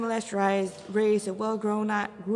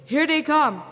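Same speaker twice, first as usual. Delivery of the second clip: loud warning shout; rally the team as the horde charges; battle shout; 3 semitones higher, 7 dB louder than usual. Acted.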